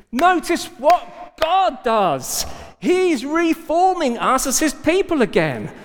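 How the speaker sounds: high, emphatic proclamation; impassioned preaching. Real, not acted. Preacher announcing God is reforming his people, impassioned. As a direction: hammer it home to the congregation.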